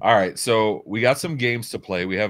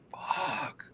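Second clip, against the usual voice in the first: whispered curse of disbelief; hushed dismay; 9 semitones higher, 10 dB quieter than usual. Retold or imitated. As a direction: let the swear out voiceless, under your breath. Reacting to favorite place burning down.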